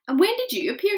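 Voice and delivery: put-on high, curious girl's question; little girl voice. A narrator's reading. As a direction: ask it as a small girl. Sophie asking.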